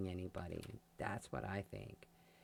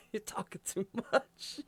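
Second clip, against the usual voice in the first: higher, laughing through the scold; laughing mock scold; 11 semitones higher, 5 dB louder than usual. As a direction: tease them while laughing. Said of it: "somebody looking at me going".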